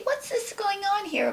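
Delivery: high-pitched mock caller; imitated caller. Imagined person calling up a hotline, high imitated voice.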